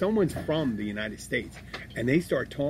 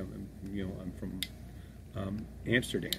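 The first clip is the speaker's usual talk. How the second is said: much softer, mild imitation of a Dutch speaker; quiet reply; 4 semitones lower, 11 dB quieter than usual. Dutch stranger answering the host's question, softly. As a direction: murmur the answer low-key, lightly voiced.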